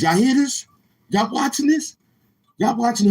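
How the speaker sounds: can you believe this, shout it up high; excited call-out. Real, not acted. Calling out to chat, amazed.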